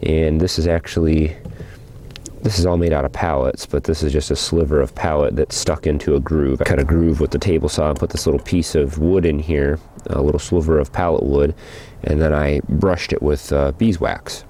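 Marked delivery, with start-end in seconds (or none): none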